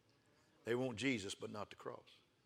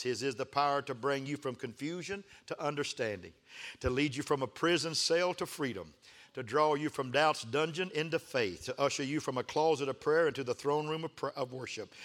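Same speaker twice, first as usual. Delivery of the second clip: ordinary talk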